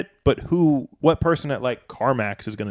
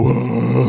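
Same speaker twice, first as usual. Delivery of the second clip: louder drawn-out gurgling whoa; imitated whoa; 5 dB louder than usual. Comedian imitating friend getting his ear flushed, loud whoa. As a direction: let out a put-on startled noise. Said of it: "and then you go".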